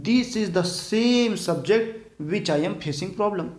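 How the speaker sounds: very slow, every word spaced; slow quoted sentence. Retold or imitated. Teacher slowly voicing what students would say. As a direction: read the students' line slowly. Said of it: "वो क्या बोलेंगे".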